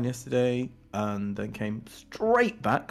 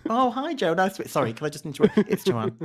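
high, bubbly greeting dropping into a correction; hammed-up cheery social voice; mock chirpy introduction; 10 semitones higher, 5 dB louder than usual. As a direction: imitate an over-eager hello, half laughing. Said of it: "introduces herself as Joe".

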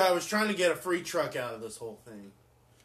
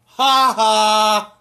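loud cackle; 6 semitones higher, 15 dB louder than usual. Real, not acted. Gleeful laugh at the news.

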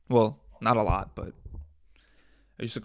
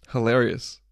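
ordinary talk, nothing marked

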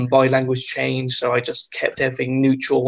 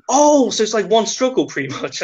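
Taliban soldier; 8 semitones higher than usual. Retold or imitated. Taliban soldier's reply, retold.

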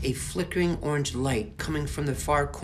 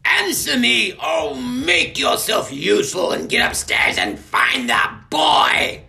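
much higher, loud, furious yell; angry shout; 12 semitones higher, 11 dB louder than usual. Acted. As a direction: scream orders at her sister. Snitch yelling at her sister.

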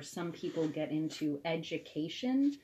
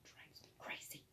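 whispering; 19 dB quieter than usual. Real, not acted. Frustrated aside under breath.

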